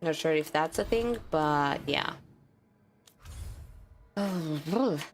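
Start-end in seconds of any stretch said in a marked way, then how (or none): none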